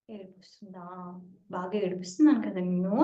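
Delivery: put-on low, hushed teasing of a crying girl; teasing guru. Guru teases the crying devotee, low and hushed. Sir teasing her while she cries.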